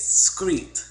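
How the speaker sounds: exaggerated Southern drawl on each word; mock Southern accent; Southern slang words. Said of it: "the things they say is".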